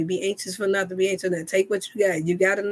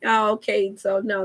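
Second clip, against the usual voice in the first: ordinary delivery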